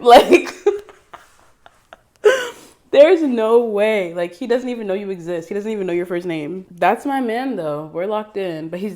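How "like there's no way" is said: giggling. Real, not acted. Giggling at boyfriend picture.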